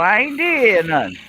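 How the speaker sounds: high, loud whiny denial; high mock protest. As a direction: play the innocent, high and whiny. Mock innocent protest repeated.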